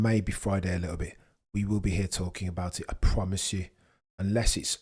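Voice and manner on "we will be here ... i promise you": normal talking voice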